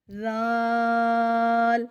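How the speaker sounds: زال held long and loud with a soft z, no buzz; soft zaal. Shown as a demonstration. Teacher showing زال softly for students. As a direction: stretch زال gently without a whistle. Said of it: "زال اور زا کو ادا کرتے ہوئے فرق کریں گے".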